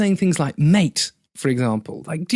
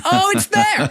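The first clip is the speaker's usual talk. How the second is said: high, sudden dawning realisation; American realising; slower than usual. Retold or imitated. Mocking the American who finally gets it, high and sudden. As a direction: squeal it as the penny drops.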